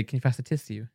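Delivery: soft, low posh voice saying tiss-yoo; posh put-on voice. Friend mimicking how some people say tissue, posh. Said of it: "Why do you put that voice on?".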